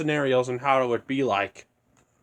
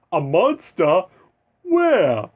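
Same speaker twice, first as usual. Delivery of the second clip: a startled cartoon cat springing the question; mock alarm in a cartoon cat voice; Cat in the Hat movie voice; 5 dB louder, slower than usual. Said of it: "like he said it in the, in the Cat in the Hat movie".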